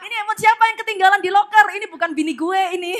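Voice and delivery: comic indignant confusion; baffled outburst. Acted. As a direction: blurt it out like a confused phone finder. Man finds stranger's phone, baffled.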